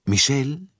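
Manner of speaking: high, brisk summons; calling out. A narrator's reading. Monsieur Bouc summons the conductor, calling out. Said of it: "rief Monsieur Bouc".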